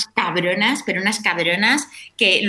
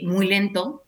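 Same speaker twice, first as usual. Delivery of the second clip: very slow, drawn out; slow motion; much slower than usual. Shown as a demonstration. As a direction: stretch each word slowly. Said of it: "comenzó a hablar muy lento".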